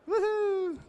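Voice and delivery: high sarcastic woohoo; a fake whoop of celebration; mock cheer.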